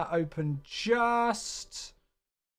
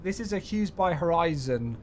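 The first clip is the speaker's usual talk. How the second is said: ordinary talk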